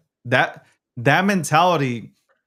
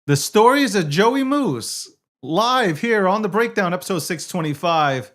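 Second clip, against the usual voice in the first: show announcer; 6 semitones higher than usual. Acted. Host reintroduces Joey Moose live on The Breakdown, announcer voice.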